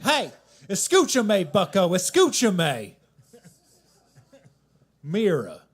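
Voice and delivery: loud, slow Southern teacher in bad Spanish; a Southern teacher faking Spanish, loud and slow; mock Spanish. Math teacher scolding a student, loud mock Spanish with southern accent. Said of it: "He would speak Spanish".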